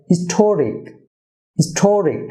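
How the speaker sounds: "Historic" as his-TO-rik; stress on second syllable. Shown as a demonstration. Teacher shows where stress goes, "historic" as his-TO-rik. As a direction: punch the "TO" in "historic". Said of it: "টিতে stress হবে".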